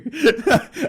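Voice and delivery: loud high laugh; real burst of laughter. Real, not acted. Laughing at his own joke.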